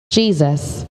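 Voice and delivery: ordinary talk, nothing marked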